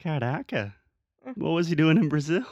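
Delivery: high, loud, hammed-up astonishment; mock amazement. Officer asking about the famous teacher, mock amazement. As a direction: play it wildly impressed, laughter close behind.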